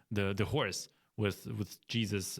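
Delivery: ordinary talk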